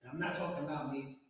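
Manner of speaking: usual speaking voice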